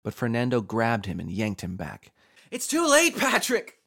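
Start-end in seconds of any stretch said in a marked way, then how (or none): 2.5-3.8 high, loud, tearful-scene scream; desperate scream. A narrator's reading. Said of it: "he screamed, tears running down his face".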